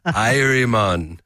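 put-on slow, lightly done Jamaican accent; a hint of Jamaican drawl. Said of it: "Let's hear your Jamaican".

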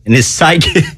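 giggling on the word; laughing. Real, not acted. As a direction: break into laughter.

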